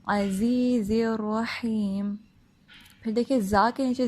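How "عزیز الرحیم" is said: slow chanted "عزیز الرحیم" from mid-throat; clear ain and ha. Shown as a demonstration. Teacher modelling "عزیز الرحیم", slow with clear throat letters. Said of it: "تو ان کو واضح کرنا ہے".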